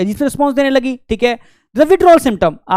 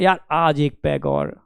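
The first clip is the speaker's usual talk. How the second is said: low, soft, slow lure of one more drink; tempting friend; 9 semitones lower, 6 dB quieter, slower than usual. Retold or imitated. Friend luring him back next day, low and slow. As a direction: draw him in, hushed and slow.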